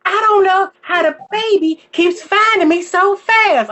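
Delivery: put-on high, slow, puzzled old mother; mock elderly mama wondering aloud. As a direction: play the baffled grandma, slow and high. Mama on oxygen quoted.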